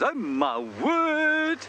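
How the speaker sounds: high, drawn-out shocked cry; a stretched-out, squealing oh my word; startled exclamation. Retold or imitated. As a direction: blurt it out in sudden alarm. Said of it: "he looks up and goes".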